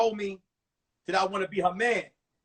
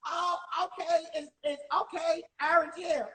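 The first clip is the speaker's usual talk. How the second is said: put-on high, softer, flustered old lady; old lady teacher; 7 semitones higher, 4 dB quieter than usual. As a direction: back down as a doddering teacher. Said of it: "She talked like this".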